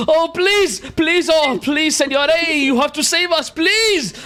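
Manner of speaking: high, desperate begging yell; yelled plea. Acted. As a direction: cry out to be rescued. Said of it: "young man yelling and saying".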